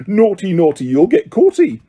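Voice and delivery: high mocking cackling taunt; cackling poltergeist. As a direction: tease them like a gleeful poltergeist. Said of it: "Peeves cackled".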